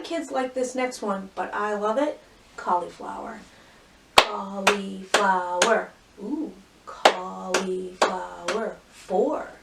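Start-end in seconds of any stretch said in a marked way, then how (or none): 4.2-5.9 "Cauliflower" slowly, cau-li-flow-er; four drawn-out beats on "Cauliflower"; chunked into syllables. Shown as a demonstration. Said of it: "Four syllables". 6.9-8.8 "cauliflower" stretched into four slow beats; chunked into syllables. Shown as a demonstration. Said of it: "Four syllables".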